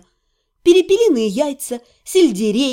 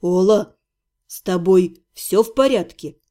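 voice trembling; 7 semitones lower than usual. A narrator's reading. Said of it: "с дрожью в голосе спросил".

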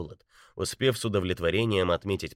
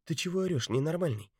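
woman's voice; 10 semitones higher than usual. A narrator's reading. Betty's voice, hushed scolding.

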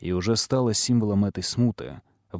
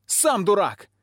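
high, louder retort; shouting; 11 semitones higher, 3 dB louder, slower than usual. A narrator's reading. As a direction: shout it back. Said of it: "кричит Огнянка". (крик в ответ)

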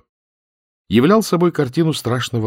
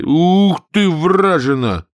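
наигранная угроза; 9 semitones higher, 5 dB louder, much slower than usual. A narrator's reading. Замахивается кулаком на корову.

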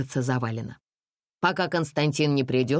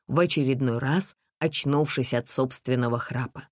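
ordinary delivery

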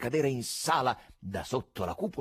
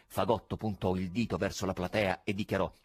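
ordinary delivery

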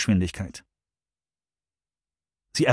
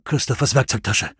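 short and clipped-off answer; curt. A narrator's reading. Jones answering Russ tersely, curt. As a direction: say it tersely, no extra breath. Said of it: "sagte Jones knapp".

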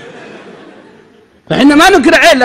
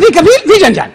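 put-on higher, louder Indian-accented reply; Indian worker accent; 10 semitones higher, 4 dB louder than usual. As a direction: answer like a worried Indian laborer. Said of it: "قال". (هندي يرد بلكنة هندية وصوت أعلى)

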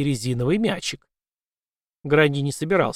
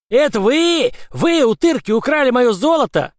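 high, loud shrieking creature voice; screaming leprechaun; 10 semitones higher, 6 dB louder than usual. Acted. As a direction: scream it out in fury. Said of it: "истошно орал он".

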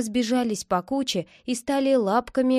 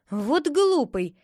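slow, exasperated exclamation; cross squirrel; 5 semitones higher, 4 dB louder, slower than usual. A narrator's reading. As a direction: scold him, drawn out. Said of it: "рассердилась белка". (сердитая белка)

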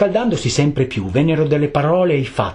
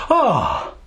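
drawn-out acted "ah" of pain; cry of pain; 3 semitones higher than usual. A narrator's reading. Geppetto struck on the shins by the wood, acted pain cry. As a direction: let out a falling yelp.

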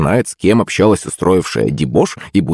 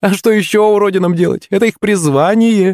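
high-pitched schoolgirl taunt; mocking girl; 14 semitones higher than usual. A narrator's reading. Tanyukha joining in against Yulenka, mocking. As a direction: pitch it way up and jeer.

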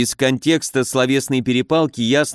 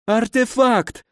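high-pitched, pained wailing; panicked injured baron; 12 semitones higher, slower than usual. A narrator's reading. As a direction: plead in high, agonised bewilderment. (высокий страдальческий голос перепуганного барона)

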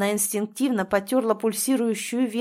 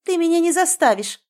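much higher and louder, rearing up; defiant outburst; 8 semitones higher, 7 dB louder than usual. A narrator's reading. Drizella defying her mother, shouted refusal. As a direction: refuse outright like a wild colt. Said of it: "словно необъезженный жеребец на дыбы встал".

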